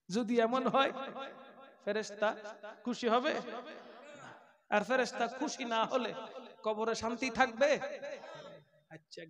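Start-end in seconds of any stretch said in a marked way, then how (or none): none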